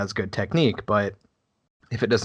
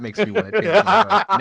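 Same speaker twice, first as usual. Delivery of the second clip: laughing; 11 semitones higher, 8 dB louder than usual. Real, not acted. Laughing at a joke.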